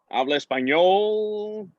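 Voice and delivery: slow, high, drawn-out question; tentative asking. Retold or imitated. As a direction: stretch it out for someone who may not understand. Trying languages on puzzled Italian strangers.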